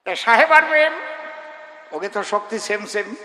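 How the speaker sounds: normal talking voice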